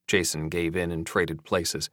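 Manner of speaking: usual speaking voice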